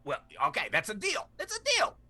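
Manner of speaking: high squawky parrot; cocky bird voice. Acted. Parrot voice continues.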